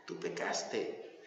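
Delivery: breathy whispered verdict, slow. Shown as a demonstration. Preacher repeats God's verdict to the sinner, whispered. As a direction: let it sink in with a hush.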